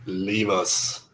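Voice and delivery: low, soft command to go; villain's dismissal. Acted. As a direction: dismiss them quietly, pitched well down.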